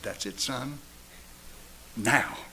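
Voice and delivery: soft, low and gentle, barely above a breath; hushed divine voice telling a son to stop; hushed voice of God. Retold or imitated. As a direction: speak it like a quiet fatherly voice in the night. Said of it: "a voice that I hadn't heard in a long time speaking like that to me".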